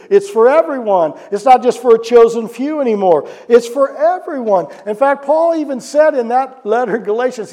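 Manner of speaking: high, loud preaching, slowed on everyone; preaching at full force. Acted. Preacher proclaiming to the congregation, loud and high. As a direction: proclaim it to the whole room.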